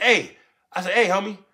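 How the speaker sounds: urgent retold holler; calling out. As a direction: call out to warn them. Warning dealers about the police.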